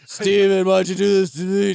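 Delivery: loud, high, hammed-up accusation; severed head of a father accusing his son, hammed-up; mock ghostly father. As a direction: wail it like a wronged dead dad.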